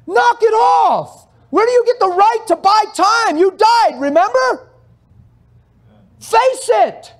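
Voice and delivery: shouted, high and slow at first; fiery rebuke. Real, not acted. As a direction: thunder it at them from the pulpit. Pastor rebukes congregation, shouting.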